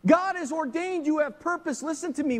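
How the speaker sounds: high, loud impassioned preaching; fiery sermon climax; preaching at full force. Acted. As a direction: drive it home from the pulpit. Preaching Nehemiah story at full force.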